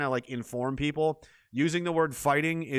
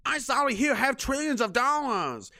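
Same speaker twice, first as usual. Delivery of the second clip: high, slow whiny fake apology; hammed-up sorry voice; mock apology; 10 semitones higher, slower than usual. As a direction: play the groveling culprit, hammed-up.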